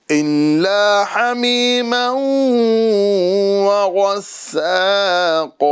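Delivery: slow, melodic tajweed chant; Quranic recitation. Acted. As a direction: recite it measured and drawn out. Recites full verse.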